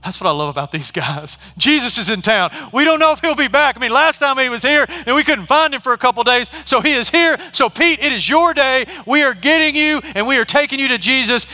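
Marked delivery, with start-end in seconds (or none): 1.6-11.5 loud, high, fired-up urging; rally a buddy at full volume; shouting with excitement; excited shouting. Acted. Friends urging Pete to meet Jesus.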